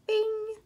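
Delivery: high ringing bing; ping it out like a bell; imitated bing. A narrator's reading. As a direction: sound effect, pitched high. Imitates a sound in story.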